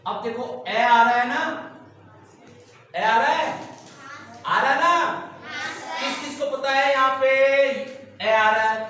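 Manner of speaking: teacher raising voice to get the class's attention; teacher calling out. Real, not acted. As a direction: call it out so the back row hears.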